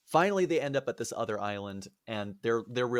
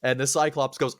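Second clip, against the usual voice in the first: ordinary delivery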